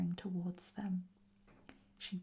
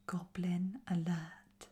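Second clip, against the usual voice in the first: ordinary delivery, nothing marked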